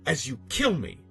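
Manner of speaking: usual speaking voice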